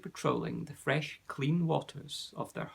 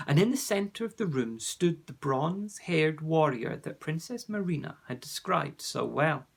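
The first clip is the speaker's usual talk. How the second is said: ordinary delivery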